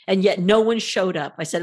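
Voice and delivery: normal talking voice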